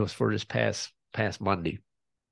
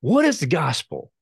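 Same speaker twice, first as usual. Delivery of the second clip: higher, louder exasperated demand; retold frustration; 6 semitones higher, 5 dB louder than usual. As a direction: act out a man losing patience. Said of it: "he just gets frustrated. He's like".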